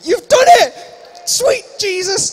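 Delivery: high, loud triumphant shout; mock amazed outburst. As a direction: yell it in exaggerated astonishment. Mock amazed outburst at audience member.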